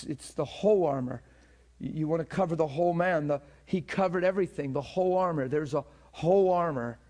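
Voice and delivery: whole hit hard every time; stress on whole. Real, not acted. Preacher drilling the whole armor point, stressing whole. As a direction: keep punching the word whole. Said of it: "I'm emphasizing that on purpose".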